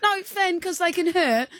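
high hammed-up worried plea; high pretend fear; mock scared pleading. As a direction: beg him to stop, pitch up. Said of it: "I was going".

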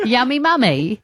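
lightly English-accented yummy mummy. Shown as a demonstration. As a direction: try a posh English accent. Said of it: "I was trying to do it in English accent".

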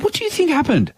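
high, incredulous question; baffled disbelief. Retold or imitated. Customer confused by the barman's accusation, incredulous and high. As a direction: pitch right up, genuinely baffled. Speaker retells own baffled reply.

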